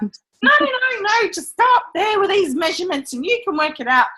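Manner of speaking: high, loud hammed-up "no, no, no, no" brushing off the objection; a mock pushy boss waving away concerns; insistent client. Retelling the client who dismissed her worries, in a mock pushy high voice.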